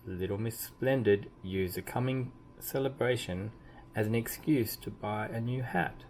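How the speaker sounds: plain reading voice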